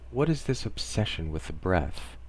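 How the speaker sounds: usual speaking voice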